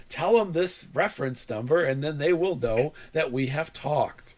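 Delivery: imitated formal, word-by-word phone agent; mock-careful support voice; over-enunciating. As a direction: recite instructions with exaggerated precision. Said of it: "but he was over enunciating".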